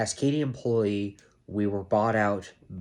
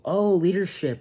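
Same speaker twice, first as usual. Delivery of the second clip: mocking the promises; 8 semitones higher, 4 dB louder than usual.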